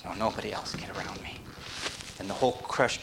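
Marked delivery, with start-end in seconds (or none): 0.1-1.4 quick unvoiced whisper; fast whispered child voice. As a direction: hiss it fast like a greedy child. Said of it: "they want to talk like this".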